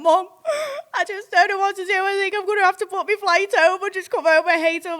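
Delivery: high, loud tearful-style wailing; mock distraught. Daughter phones mum about leaving boyfriend, mock distraught. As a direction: wail it like a hammed-up meltdown.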